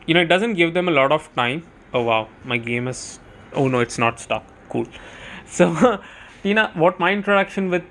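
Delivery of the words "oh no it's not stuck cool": ordinary talk, nothing marked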